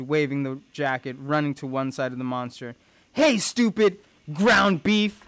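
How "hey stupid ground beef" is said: slow, high, loud jeer; screamed taunt. Acted. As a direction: yell an insult to lure the monster.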